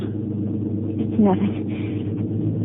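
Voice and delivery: breathless, very soft whisper; faint whisper. Acted. Virginia to Gordon in the car, whispering. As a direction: barely get the words out.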